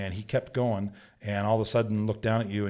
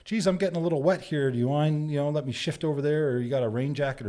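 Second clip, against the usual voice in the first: polite tourist; 6 semitones higher than usual. Retold or imitated. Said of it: "they probably would have said".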